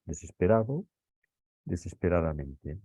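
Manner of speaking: ordinary delivery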